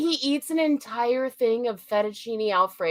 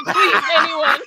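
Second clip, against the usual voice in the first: mock desperate plea; 4 semitones higher, 7 dB louder than usual. Acting Michael's desperate plea.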